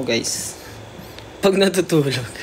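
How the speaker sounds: ordinary delivery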